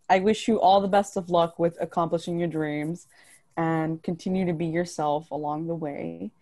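normal talking voice

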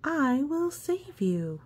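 high, bold promise; lady bravely offers rescue; Lady Callie. Acted. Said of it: "says Lady Callie".

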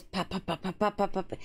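quick repeated popping syllables; short percussive pops, like fizz from an opened can; imitating a pop can. Shown as a demonstration. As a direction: patter "pop" over and over. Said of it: "it makes the sound".

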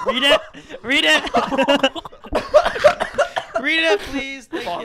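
high, loud plea through laughter; shrieking with laughter; laughing demand. Real, not acted. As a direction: egg them on while cracking up. Begging for the card amid laughter.

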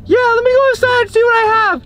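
imitating the lady. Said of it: "She's like".

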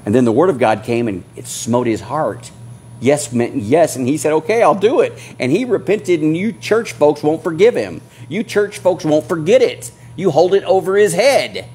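high, loud, driving sermon voice; fiery preacher rebuking the congregation; full-force preaching. Acted. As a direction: preach it hard to the church folks.

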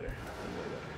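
whispered repeat; whispering. Shown as a demonstration. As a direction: breathe it as a whisper.

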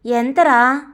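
plain reading voice

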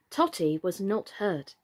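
ordinary delivery, nothing marked

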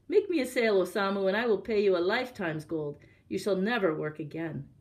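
ordinary delivery

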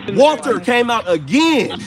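high, loud burst of excitement; thrilled, nearly shouting it out; excited. Real, not acted. Spirit answers on ghost hunt.

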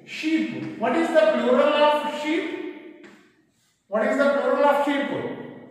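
ordinary delivery

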